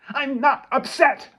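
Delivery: loud, high, slow shout of denial; angry shout. Acted. Daughter shouting at her mother in the restaurant, furious. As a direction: yell it back at her.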